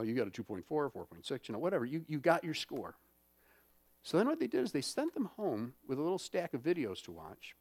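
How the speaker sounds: usual speaking voice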